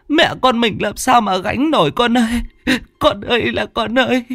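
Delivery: high, wailing mother begging her son; sobbing plea. Acted. Mother clinging to her son's legs, high sobbing plea. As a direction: beg through tears, high and pleading. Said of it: "mà khóc nức nở".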